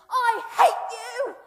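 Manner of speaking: a crying, very loud, slow scream, high; furious shout. Acted. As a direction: scream it at her in front of the whole church. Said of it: "he stood up and he shouted".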